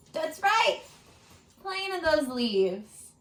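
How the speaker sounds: ordinary delivery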